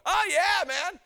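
eager heavenly bragging, voice up; excited testimony. Acted. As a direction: act out a martyr proudly telling his story. Stephen testifying in heaven, imagined.

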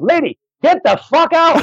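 very high, drawn-out mock yell; exasperated yell. Club cleaner to customer who won't leave, hammed-up exasperated yell. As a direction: imitate a fed-up cleaner hollering at a customer.

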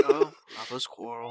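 low, soft, chuckling; mock elder. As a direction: chuckle it knowingly. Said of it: "he's just like".

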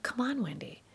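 whisper, urging yourself to let it go; soft, breathy nudge to yourself; whispered self-pep talk. Retold or imitated. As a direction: whisper to yourself mid-walk, coaxing yourself to calm down. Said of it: "I'm like".

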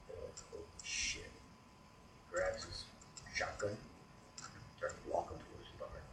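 low, hushed storytelling; quiet, creeping dread; hushed suspense. A narrator's reading. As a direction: drop almost to a whisper for suspense. Suspense build in creature story.

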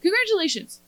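Congratulations. said high and sharp; Belle's cutting quip. Retold or imitated. Belle cutting down the villain with a high Congratulations. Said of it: "She's like".

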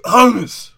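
very loud, high HUMMUS after guttural choking; exaggerated gagging pronunciation. Mocking how every word is said, gagged "hummus". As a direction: hack and retch, then blurt "hummus". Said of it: "act like they just sucked".

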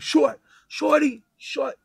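a local greeting a Texan the DC way, slow and drawn out; DC street accent. Retold or imitated. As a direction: stretch out "Shorty" like a local from around DC. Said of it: "That's how they talk out there".

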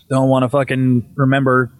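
ordinary delivery

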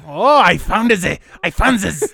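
high, loud mock-German scientist; horrible German accent. German scientist announcing his gene discovery, excited bad German accent. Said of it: "That's a horrible German accent".

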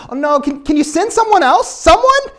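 high, loud plea climbing to the end; mock pleading. Preacher as Moses begging God, mock desperate plea. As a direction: beg off, voice shooting up on the last word.

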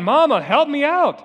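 high, wailing plea; boy on the floor still crying for his mother; crying child. Retold or imitated. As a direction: call out for mama through tears. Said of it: "He's on the floor crying".

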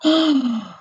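sharp mock gasp; a loud theatrical gasp; shocked gasp. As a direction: gasp as if scandalised.

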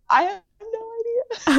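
high, slow, sheepish admission. Retold or imitated. Caught lying about Haiti, high sheepish admission. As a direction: squeak out the confession slowly.

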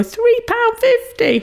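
high-pitched disbelief; outraged. Real, not acted. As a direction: squeal the price in shock. Horrified at a pricey shop box.

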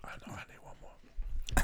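ASMR whisper. Pretend. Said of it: "ASMR bit".

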